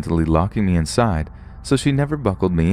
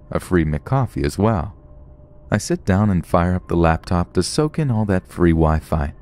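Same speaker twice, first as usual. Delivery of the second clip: ordinary delivery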